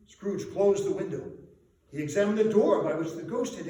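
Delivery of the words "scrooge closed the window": ordinary delivery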